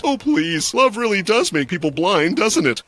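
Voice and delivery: high, scornful and mocking. A narrator's reading. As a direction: sneer at the idea. Dan mocks.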